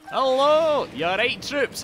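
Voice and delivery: high, loud hello; hearty shouted greeting. Real, not acted. Streamer greeting the troops, hearty shouted greeting. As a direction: call out to the whole crowd. Hailing arriving viewers.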